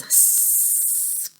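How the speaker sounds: long, steady hissing snake sound. Shown as a demonstration. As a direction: hiss like a snake on one long breath. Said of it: "A snake says".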